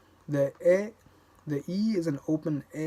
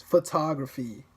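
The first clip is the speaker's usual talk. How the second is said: photography with TOG punched louder; stress on second syllable; 4 dB louder than usual. Shown as a demonstration. Showing English stress with more force on the second syllable, emphatic. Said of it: "say the second syllable with more force".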